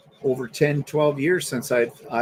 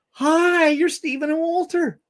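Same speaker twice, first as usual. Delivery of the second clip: high, eager fan voice; excited fan greeting; 14 semitones higher, slower than usual. Retold or imitated. Stranger greeting Stephen and Walter, high and eager. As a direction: gush like a surprised fan. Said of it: "come up to us out of the blue and go".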